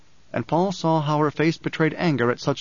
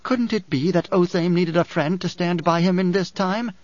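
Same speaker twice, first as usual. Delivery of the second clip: higher character voice, pointed question; Dory, reproachful woman; 5 semitones higher than usual. Acted. Dory berates Paul for his callousness, reproachfully.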